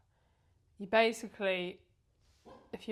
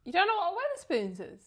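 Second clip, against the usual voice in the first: incredulous; 8 semitones higher, 3 dB louder than usual. Real, not acted. Disbelief at host.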